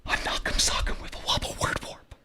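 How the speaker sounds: whispered in awe, very soft; hushed whisper. A narrator's reading. Shocked listeners echoing the nurse, hushed whisper.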